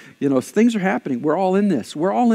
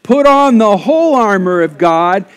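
loud, slow, raised public reading; scripture declaimed; 3 semitones higher, 6 dB louder, slower than usual. Real, not acted. Pastor leads congregation reading scripture out loud, declaimed. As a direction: proclaim it slowly and forcefully for all to join in.

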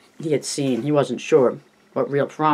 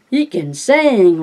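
higher, louder, slow screech; screeching caricature voice; 6 semitones higher, 5 dB louder, much slower than usual. Retold or imitated. Richie praising Stan's singing in a screeching caricature voice. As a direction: stretch it out in a shrieking caricature. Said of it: "Richie screeched in his pickaninny voice".

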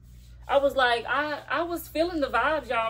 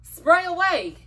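excited; 5 semitones higher, 5 dB louder than usual. Real, not acted. Hyped advice.

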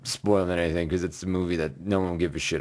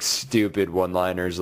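ordinary talk

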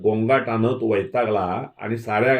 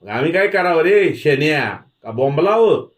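loud, high-pitched jeer; angry taunt; 6 semitones higher, 6 dB louder than usual. A narrator's reading. Village elder berating Dhanaji over the women, loud angry taunt. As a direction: throw it at him as an insult.

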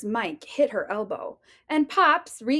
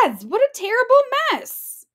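much higher, louder and fast outcry; shocked cry; 12 semitones higher, 7 dB louder, faster than usual. Acted. Mama Bear sees the mess, crying out in shock. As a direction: shriek it out in horror. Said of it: "the Mama Bear cried".

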